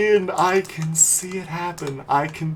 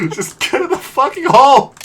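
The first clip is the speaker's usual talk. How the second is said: louder, higher, fast burst of frustration; exasperated yell; 4 semitones higher, 7 dB louder, faster than usual. Real, not acted. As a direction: yell at the stubborn golf ball. Frustrated at missed putt.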